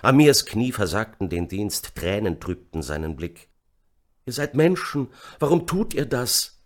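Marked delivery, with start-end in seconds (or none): 4.2-6.6 high-pitched pleading cry; cried out. A narrator's reading. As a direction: plead desperately with the killers. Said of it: "schrie die Stimme". (flehend aufgeschrien)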